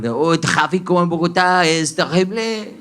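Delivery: put-on Spanish in a thick French accent. French driver complaining about Bogotá traffic, French-accented Spanish. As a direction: imitate a Frenchman struggling through Spanish. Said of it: "Yo pasé por un man francés".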